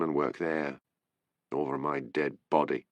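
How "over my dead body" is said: low, under-the-breath grumble; a muttered refusal. A narrator's reading. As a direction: mutter it to yourself, defiant. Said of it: "he muttered".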